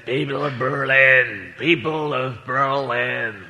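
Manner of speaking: a hint of Kennedy orating; Kennedy impression. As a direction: address the crowd slowly. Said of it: "I can't do President Kennedy as an impression".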